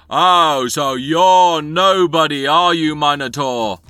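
slow, high, booming bellow; yelling guard. Acted. Guard challenging a minotaur and companions, yelling. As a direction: bellow scornfully, stretching the last words. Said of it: "the guards immediately yell at you".

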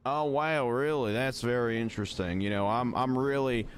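country Southern accent, playing along; hammed-up Southern accent; put-on Southern accent. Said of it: "I'm stuck in this accent now".

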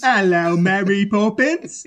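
bad Cockney accent, drawn out; hammed-up mock Cockney greeting. Co-host mimicking the accent from Mary Poppins, bad Cockney.

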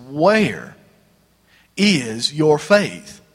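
drawn-out, weighty question; Jesus gently challenging the disciples, very slow; slow rebuke. Acted. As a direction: stretch out "is your faith" slowly.

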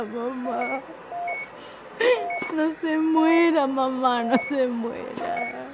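slow, broken, begging through tears; tearful pleading. Acted. Daughter begs her dying mother on the phone, tearful and slow. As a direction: plead as if she could still hear you.

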